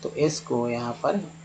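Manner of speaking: ordinary delivery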